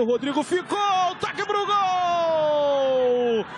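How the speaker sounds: high, long held goal cry; explosive goal commentary. Real, not acted. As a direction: stretch the call, then shout it. Goal called on live broadcast.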